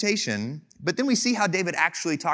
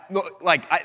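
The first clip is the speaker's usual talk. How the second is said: normal talking voice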